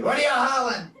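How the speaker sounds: high, loud trucker bellow; hammed-up trucker holler; trucker talk. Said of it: "talk to you like a trucker".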